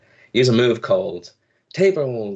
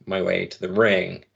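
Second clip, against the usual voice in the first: ordinary delivery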